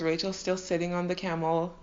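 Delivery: ordinary delivery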